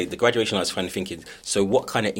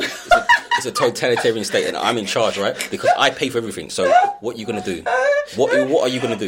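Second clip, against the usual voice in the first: louder, higher, jokey laying down the law; mock authoritarian; 4 semitones higher, 7 dB louder than usual. Parent who pays for everything tells someone off, mock-bossy. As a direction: play the parent who is in charge. Retells scolding, parent in charge.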